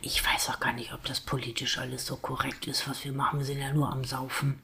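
unvoiced, soft whisper. Real, not acted. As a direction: whisper it like a secret. Confides whispering.

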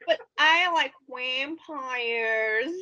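"vampires" stretched slowly, starting on a w sound; drawn-out odd pronunciation. Real, not acted. Denying any mockery, "vampires" said slowly with a w. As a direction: draw out "vampires" with a w at the front. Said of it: "Why are you saying it like that?".

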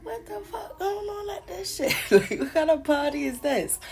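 high, stunned outburst with a laugh; can't believe what she's seeing; shocked disbelief. Retold or imitated. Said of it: "I was like".

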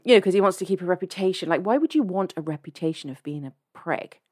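ordinary delivery

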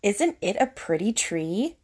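ordinary delivery

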